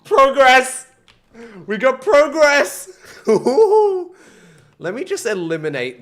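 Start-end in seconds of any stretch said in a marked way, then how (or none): 0.0-3.0 loud, high-pitched celebration; elated yell breaking into laughter; triumphant shout. Real, not acted. Celebrates finally making progress. 3.0-4.1 loud, high laughing whoop; high-pitched victory cackle; gleeful whoop. Real, not acted. Celebrates finally making progress.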